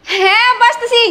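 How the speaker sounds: high stalling call; calling back. Acted. Daughter answers father at the gate, calling back. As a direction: call that you're coming.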